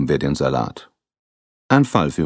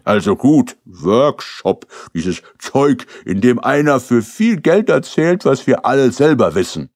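higher, louder scornful grumble about the seminar; grumbling husband; 8 semitones higher, 5 dB louder than usual. Acted. Husband scoffing to the detectives about the workshop, scornful. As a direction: sneer at the expensive nonsense.

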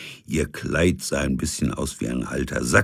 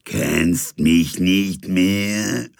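very slow, thin ghostly murmur; papery ghost voice; 3 semitones higher, 4 dB louder, much slower than usual. Acted. First ghost haunts the sleepless captain, slow and papery. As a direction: drag it out like a dead voice. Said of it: "schien er eine papierne Stimme flüstern zu hören".

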